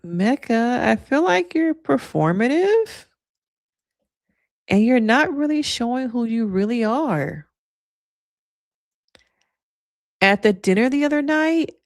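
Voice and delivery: [name]. Acted. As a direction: play a reality-TV confrontation, stretching phrase endings. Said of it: "the Kim K voice".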